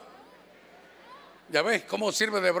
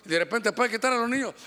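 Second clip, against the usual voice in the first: normal talking voice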